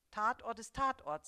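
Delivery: a husband's stern verdict. A narrator's reading. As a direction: lay down the rule sternly. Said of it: "sagt der Gatte streng".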